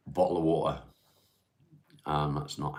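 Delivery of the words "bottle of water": casual English accent, "bottle of water" with dropped t's; everyday English accent. Shown as a demonstration. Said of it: "most English will say".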